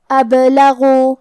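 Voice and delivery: ابلغوا slow, with a bounced با and a heavy غ; qalqala on the ba. Shown as a demonstration. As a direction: bounce the با in ابلغوا, full-mouthed غ. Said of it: "ہمیں یہ حرف کو ہلاتے ہوئے یہ کلمہ کو پڑھنا ہے". (ابلغوا میں با پر قلقلہ اور غین موٹی آواز میں)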